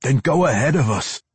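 urgent plea edged with desperation; Drizzt. A narrator's reading. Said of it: "voice raising in a bit of desperation".

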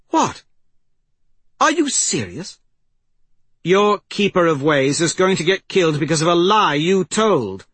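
high, loud, indignant challenge; incredulous outrage. Acted. Persephone confronts Conager over his refusal, incredulous outrage. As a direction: throw it back in disbelief. Heated argument over a lie.

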